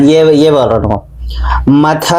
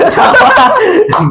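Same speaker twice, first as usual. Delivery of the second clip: burst of laughter. Real, not acted. Laughing hard after a fumbled tongue twister.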